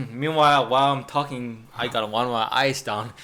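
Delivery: ordinary delivery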